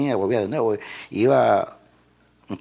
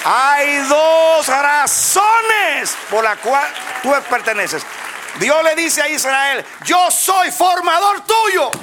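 much higher and louder, slow fervent preaching; preaching at full force; 11 dB louder, slower than usual. Acted. Preacher proclaiming God's words to the congregation, fervent shout. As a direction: proclaim God's word to the church.

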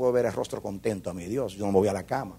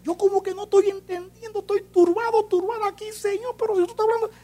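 high, pleading cry to the Lord; high distressed plea to the Lord; troubled kneeling prayer. Retold or imitated. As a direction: beg God to explain, much higher. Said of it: "tírese de rodillas y diga".

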